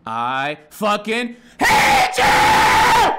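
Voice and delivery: full-throated, drawn-out shriek; hateful scream. Retold or imitated. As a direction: build from loud into an all-out scream. Acting out a jail inmate screaming.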